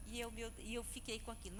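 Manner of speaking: ordinary talk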